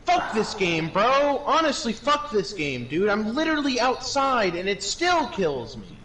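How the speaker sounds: high furious yelling; panicking gamer. Real, not acted. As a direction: rage at the game, screaming. Gamer dying near the exit.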